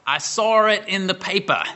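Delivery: put-on lightly done New Hampshire accent, "paper" with a dropped r. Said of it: "his way of expressing that was to say".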